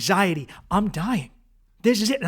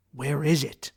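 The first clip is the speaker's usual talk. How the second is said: normal talking voice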